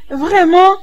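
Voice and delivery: excited and incredulous; thrilled disbelief. Acted. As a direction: exclaim it in delighted surprise. Thrilled at friend's marriage news.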